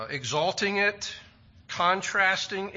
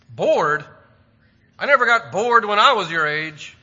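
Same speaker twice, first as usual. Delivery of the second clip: loud and higher, a mock indignant mother; indignant mom; 4 semitones higher, 10 dB louder than usual. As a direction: scoff at the word Bored, then lecture. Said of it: "every kid has heard mom say".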